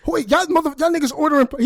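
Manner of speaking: high, loud outraged yell; pissed off. Retold or imitated. Dad outraged at family over pie, pissed off. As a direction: blow up at the family. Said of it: "he's pissed".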